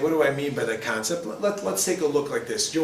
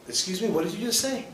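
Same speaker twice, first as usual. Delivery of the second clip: higher, sharp indignant question; indignant challenge; 6 semitones higher than usual. Retold or imitated. To a spouse who insulted you, indignant pushback. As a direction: call them on it, affronted.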